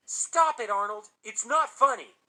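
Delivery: high, very loud outburst; frightened, involuntary yell; shouting. A narrator's reading. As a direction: shout it out sharply, scared and wanting it stopped. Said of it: "I shouted".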